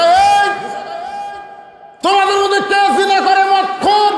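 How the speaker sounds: high, loud commanding shout; shouted summons. Acted. Musa orders the sinner out of the gathering, shouting. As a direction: call the sinner out across a huge crowd.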